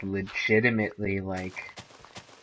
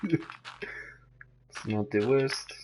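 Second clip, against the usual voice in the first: drawn out; 3 semitones higher, much slower than usual. Real, not acted. Drawn out hedging.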